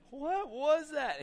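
high, drawn-out astonishment; stunned disbelief. Retold or imitated. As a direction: ask slowly, utterly baffled. Retold stunned onlookers.

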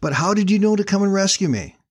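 overjoyed, babbling his thanks; full of excitement. Acted. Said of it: "full of excitement".

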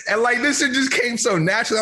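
high, amused disbelief; laughing. Real, not acted. Host laughing at his own habit, amused. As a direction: laugh at yourself. Laughing about Nigerian gesture.

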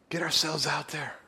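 sudden whispered urging. Real, not acted. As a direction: lean in and whisper it to the congregation. Preacher drops to a whisper.

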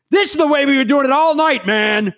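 indignant protest. Retold or imitated. Quarrel, called a shouting match.

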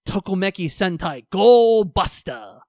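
loud, stretched-out team name call; announcer-style title shout. Pretend. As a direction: shout it like a hero show intro. Hammed-up shout of show title.

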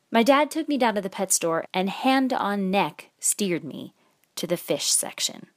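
usual speaking voice

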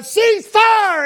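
loud, high imitated soldier's shout; hollered battlefield command. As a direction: belt out the order to halt fire. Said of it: "started hollering out".

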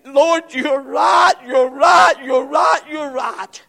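high, loud repeated cry; pleading shout. Acted. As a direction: concede it loudly, begging. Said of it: "She said".

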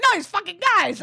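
high-pitched exasperated complaint; so mad. Retold or imitated. Cyclist explains the fight to a friend, so mad. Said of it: "I was so mad".

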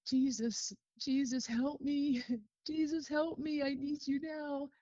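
put-on a child begging Jesus through tears; desperate plea. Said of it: "I could hear coming out of my mouth".

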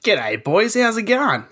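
put-on Aussie accent, high and friendly; Australian accent. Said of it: "that's my Australian impersonation".

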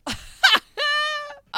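high cry-like laugh; high squealing laugh. Real, not acted. Player realizes it's the stable boy, squealing laugh. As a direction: squeal at the twist.

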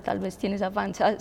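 ordinary delivery

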